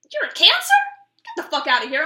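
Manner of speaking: high shriek into loud dismissal; outraged disbelief. Acted. Scorpio rejecting a suitor over star sign, mock outrage. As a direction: shriek the question, then kick them out.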